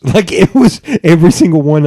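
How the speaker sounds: can barely get it out for laughing; giggling disbelief. Real, not acted. Laughing at fake names.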